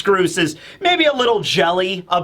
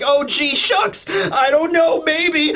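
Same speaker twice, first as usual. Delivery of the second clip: put-on high-pitched aw-shucks bashful boy; hammed-up flustered acceptance; Kobe; 9 semitones higher, slower than usual. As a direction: imitate a boy coyly dithering. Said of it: "And Kobe's like".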